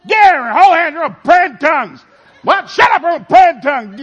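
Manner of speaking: high loud orders; commanding yell. Retold or imitated. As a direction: shout them into a prayer circle. Ordering family to pray.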